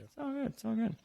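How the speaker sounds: soft, high sing-song all good; high reassurance. Pretend. Host easing off his cohost in a soft high lilt. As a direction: ease him off lightly, high and soft.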